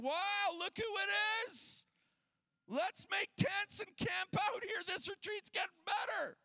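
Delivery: awestruck excitement. Retold or imitated. Peter and his boys see the glory, acted awestruck excitement. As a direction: play a disciple tripping out with glee.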